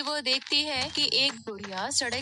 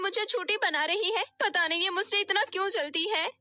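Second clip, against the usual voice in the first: fake crying; 8 semitones higher, 5 dB louder, faster than usual. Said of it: "नीति रोने का नाटक करने लगती है".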